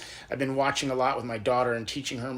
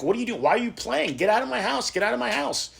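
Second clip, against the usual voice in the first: high-pitched, exasperated retold outburst; indignant father; 7 semitones higher than usual. Father to hired mourners in his house, acted high-pitched indignation. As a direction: act out a father ordering intruders out, voice pitched up. Father angrily clears out the players.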